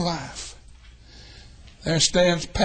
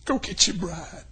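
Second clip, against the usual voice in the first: ordinary delivery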